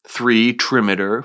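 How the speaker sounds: ordinary talk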